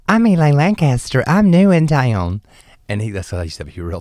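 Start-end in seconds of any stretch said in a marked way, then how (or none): 0.1-2.4 higher, louder, sweet drag-queen introduction; coy self-introduction. Retold or imitated. Introducing herself to the club manager, higher and louder. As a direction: announce yourself grandly, pitched up and beaming. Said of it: "I said".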